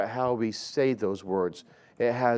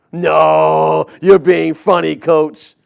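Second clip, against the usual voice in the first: put-on loud, higher teenage retort; mock teen protest; 4 semitones higher, 11 dB louder, slower than usual. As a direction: shout it back at the coach, teasing. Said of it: "They'd be like".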